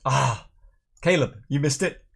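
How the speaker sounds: ordinary delivery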